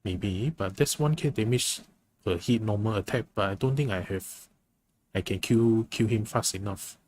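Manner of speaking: normal talking voice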